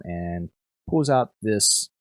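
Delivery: usual speaking voice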